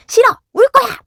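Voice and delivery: put-on high, loud whiny kid's refusal; defiant child. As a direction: shriek it like a stubborn toddler.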